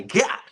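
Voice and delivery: high, short "gah!"; sudden exclamation. Real, not acted. Reader breaking off from the text, sudden exclamation. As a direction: blurt out a high-pitched yelp. Cries out while reading aloud.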